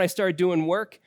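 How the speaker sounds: usual speaking voice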